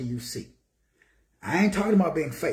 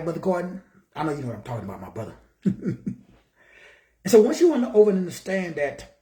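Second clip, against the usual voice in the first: ordinary talk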